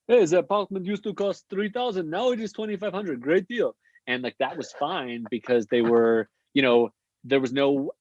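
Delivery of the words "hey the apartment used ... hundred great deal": put-on high-pitched salesy Israeli accent; mock Israeli real estate pitch; Israeli realtor impression. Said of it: "I was trying to imitate realtors".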